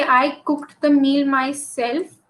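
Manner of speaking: slow, deliberate example sentence; emphatic example. Shown as a demonstration. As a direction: read out slowly, weighing each word. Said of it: "you're trying to emphasize the fact".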